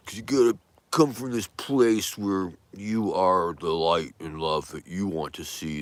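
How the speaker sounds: a light touch of pulpit sincerity; gentle sermon. Acted. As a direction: bless the viewers like a kindly minister. Closing blessing, preachy.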